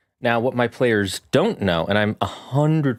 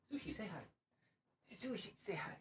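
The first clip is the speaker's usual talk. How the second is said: coaxing pet voice; 9 semitones higher, 27 dB quieter than usual. Real, not acted. Coaxing cat to greet viewers.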